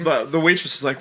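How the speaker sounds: normal talking voice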